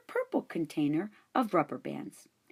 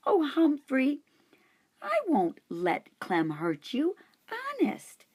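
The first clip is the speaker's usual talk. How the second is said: reassuring; 9 semitones higher than usual. A narrator's reading. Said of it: "Miranda assured me".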